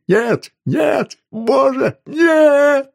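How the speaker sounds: very high, loud, tearful screams; insane wailing. A narrator's reading. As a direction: scream like someone out of their mind. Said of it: "завопил, как могут вопить только умалишённые". (истошный вопль безумца)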